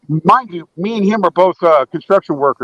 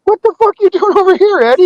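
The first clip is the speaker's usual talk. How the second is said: surprised shout; 14 semitones higher, 4 dB louder than usual. Retold or imitated. Retelling surprise at the neighbor.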